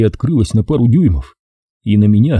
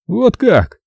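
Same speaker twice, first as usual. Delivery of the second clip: put-on higher sneering young man; 12 semitones higher than usual. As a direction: drop the politeness, sneer. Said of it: "Вежливые интонации ... улетучились".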